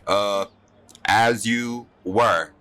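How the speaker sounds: slow, stiff "carry on" from an official; awkward guard. Acted. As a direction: clear your throat, then a loud, halting dismissal. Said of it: "And he's like".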